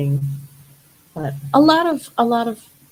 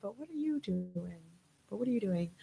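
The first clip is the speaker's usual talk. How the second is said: very soft quoted comeback; muted retort; 13 dB quieter than usual. Retold or imitated. Model quoting her reply to jealous critics, very soft. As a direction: toss the retort back under your breath.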